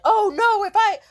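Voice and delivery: hammed-up tearful stammer; mock flustered apology. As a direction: parody a caught-out panicking friend. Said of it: "They were like".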